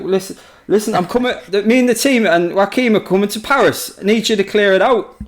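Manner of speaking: Ridley Scott impression. Ridley ordering the advisor to clear Paris, mock bossy. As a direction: give orders like an impatient big boss. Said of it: "he's like".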